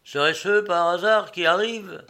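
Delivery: pointed retort. A narrator's reading. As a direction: question him pointedly, voice lifted. Captain's sarcastic query about luggage.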